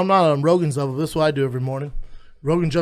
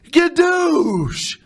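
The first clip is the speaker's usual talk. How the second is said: high, louder comic holler; mock catchphrase shout; 14 semitones higher, 5 dB louder than usual. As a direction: blast it out high, dragging the last syllable.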